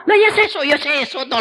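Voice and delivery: high teasing father; father parroting his son's worry; mock echo of son. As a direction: echo him in a lifted teasing tone. Father mocks son's words.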